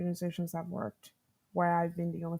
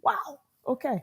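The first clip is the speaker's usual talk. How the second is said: a slow, disbelieving "wow, okay", pitched up; dry, incredulous reaction, slow and high; mock stunned; 8 semitones higher than usual. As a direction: let the disbelief hang on each word. Stunned at boss's reply.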